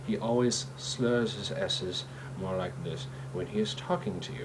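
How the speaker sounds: S's slurred in "slurs his S's". Shown as a demonstration. Showing the actor's lisp-like habit, every S in "S's" slurred. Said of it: "He doesn't say his S's correctly".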